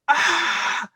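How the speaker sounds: high-pitched startled scream; re-enacted scream. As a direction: yell as if waking to a figure by the bed. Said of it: "'cause I was screaming".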